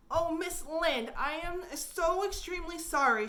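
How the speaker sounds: a touch of tearful pleading to Miss Lynde; quivering voice. A narrator's reading. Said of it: "with a quiver in her voice".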